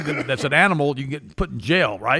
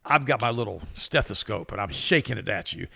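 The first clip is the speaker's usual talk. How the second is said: usual speaking voice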